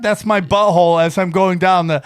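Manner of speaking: ordinary talk, nothing marked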